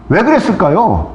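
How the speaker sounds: ordinary talk, nothing marked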